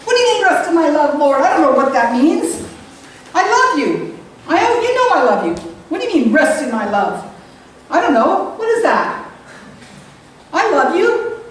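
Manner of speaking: high, fast, bewildered protest to God; exasperated pleading. Retold or imitated. Arguing confused with God.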